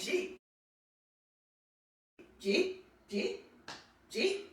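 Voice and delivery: "Jeet" as one quick, quiet rising syllable; did you eat squashed into "Jeet"; soft, repeated Queens "Jeet" question; Queens slurred "Jeet". Shown as a demonstration. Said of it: "that's the way we say it at home in Queens".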